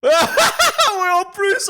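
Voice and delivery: high, loud, laughing burst; words spilling through laughter. Real, not acted. Bursting out laughing while talking.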